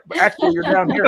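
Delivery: real laugh bursting through the words. Real, not acted. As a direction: giggle through it, high and loud. Laughs at own camera mistake.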